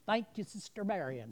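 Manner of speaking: loud, high shout across the room; calling out thanks. Real, not acted. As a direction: thank her so the whole room hears.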